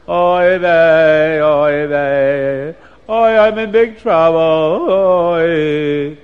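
loud sing-song wailing; crying. Acted. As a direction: wail each "oy" long and loud, like mock crying. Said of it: "they hear crying".

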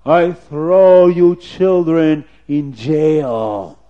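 loud, very slow drawn-out threat; angry officer. Acted. Officer threatening the children with jail, slow angry bellow. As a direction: thunder it out as a sentence. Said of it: "the officer got so angry".

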